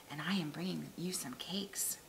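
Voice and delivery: breathy whispered wolf. Acted. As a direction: whisper it slyly through the door.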